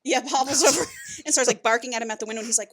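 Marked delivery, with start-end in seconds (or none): none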